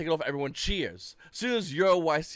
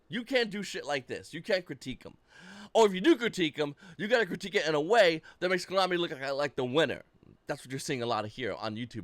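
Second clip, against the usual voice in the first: ordinary delivery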